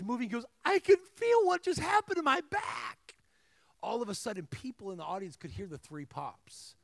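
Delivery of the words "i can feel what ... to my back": high-pitched imitation of a man crying out; retold scream. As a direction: act out his astonished high-voiced cry. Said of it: "he starts screaming".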